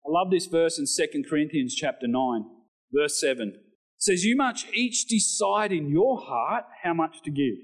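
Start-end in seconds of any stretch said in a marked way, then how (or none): 4.3-7.6 raised, measured Bible reading; scripture reading. Acted. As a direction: read the passage out to the church with weight. Reads verse aloud.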